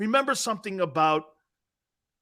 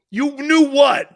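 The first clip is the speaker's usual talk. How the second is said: high, loud scoffing challenge; incredulous retort; 9 semitones higher, 10 dB louder, slower than usual. Real, not acted. Cohost scoffs at his partner's claim, loud and incredulous. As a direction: throw it back at him in disbelief.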